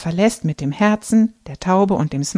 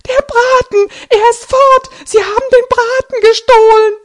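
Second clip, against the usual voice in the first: shrill, much higher and louder cry; distraught shriek; 10 dB louder than usual. A narrator's reading. Grandma shrieks to family that the roast is stolen. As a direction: wail it out, completely beside yourself. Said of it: "Sie ist völlig aufgelöst".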